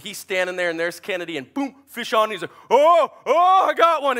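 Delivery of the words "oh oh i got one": high, loud whoops of excitement; excited shout. Retold or imitated. Son hooks a fish while fishing, excited shout. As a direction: yell out that a fish is on. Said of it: "and he's all excited".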